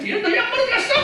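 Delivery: high, loud mock-scolding mom; comic shriek of a telling-off mother. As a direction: shout it like mom catching her kid out. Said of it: "엄마가 뭐라 그래?".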